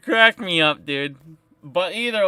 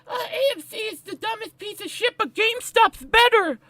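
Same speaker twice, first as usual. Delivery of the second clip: much higher, silly nasal-free mock voice; mocking critic; 12 semitones higher, 3 dB quieter than usual. Mimicking critics who mock AMC, high and sneering. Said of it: "Every fucking day I hear".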